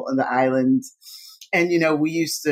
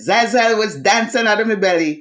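put-on West Indian accent; 6 semitones higher, 4 dB louder than usual. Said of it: "My mother said in her West Indian accent".

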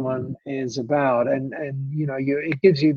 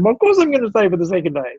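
quick, higher, louder "of course" reply; tossing off an obvious yes, fast and bright-up; eager retold reply; 6 semitones higher, 4 dB louder, much faster than usual. Telling the hosts he will stay, quick and higher.

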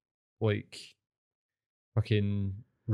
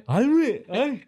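mouth full; 13 semitones higher, 8 dB louder, slower than usual. Real, not acted. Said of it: "Let me just swallow this back".

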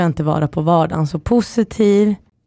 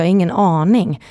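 normal talking voice

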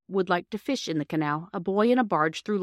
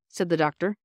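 usual speaking voice

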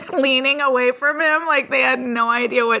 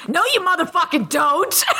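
retold angry outburst; 7 semitones higher than usual. Retelling the customer swearing at her, mock furious. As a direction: act out his fury, half amused.

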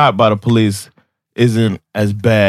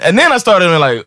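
ordinary talk, nothing marked

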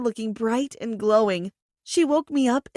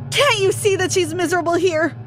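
higher, louder angry shout; 7 semitones higher, 4 dB louder than usual. A narrator's reading. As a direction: yell it at her, upset. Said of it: "I shouted at my mom".

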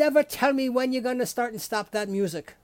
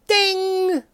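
high sung ding; mock game show ding; ding chime; 8 semitones higher than usual. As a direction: imitate the correct-answer bell.

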